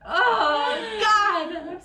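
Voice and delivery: high, loud laughing cry; laughing exasperation. Real, not acted. Host despairing at her own manners, laughing. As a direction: let it burst out, half laugh, half wail.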